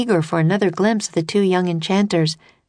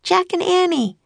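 put-on high girlish call of their names; Annie, calling out brightly; girl shouting. A narrator's reading. Annie answers the stranger's call, a mild shout. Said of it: "shouted Annie".

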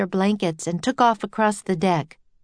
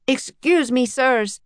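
mild raised call; shouting; 5 semitones higher, slower than usual. A narrator's reading. Henry interrupting the arguing men, mild shout. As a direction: call for their attention, slightly higher. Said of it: "he shouted".

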